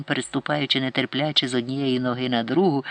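usual speaking voice

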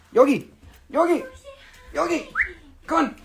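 high-pitched coaxing pet call; calling a puppy. Real, not acted. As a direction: call the dog over in a high voice.

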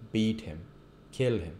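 ordinary talk

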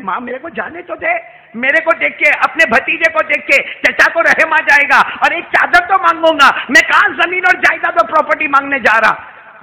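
put-on high, loud pleading child's voice; voicing a desperate son; pleading boy. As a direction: beg like an eight-year-old boy. Boy pleading with mother, preacher voicing.

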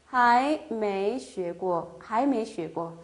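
还没学过 stretched syllable by syllable; slow model reading. Shown as a demonstration. Teacher modelling the sentence, very slow. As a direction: read it very slowly. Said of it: "아직 배워본 적이 없다".

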